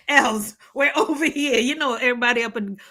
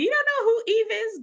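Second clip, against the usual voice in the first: incredulous; 11 semitones higher than usual. Real, not acted. Incredulous again.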